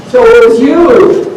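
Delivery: loud slow gotcha; triumphant shout. Acted. Farmer catching the rabbit at last, loud triumphant. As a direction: catch the thief red-handed.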